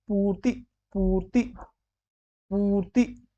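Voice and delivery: पूर्ति with drawn-out "poo"; पूर्ति slowly, as POOR-ti; long "oo" demo. Shown as a demonstration. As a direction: hold the पू in पूर्ति, then short ति. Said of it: "पू यानी कि बड़ा होगा".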